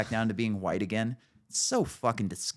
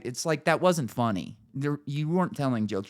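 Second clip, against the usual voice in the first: ordinary talk, nothing marked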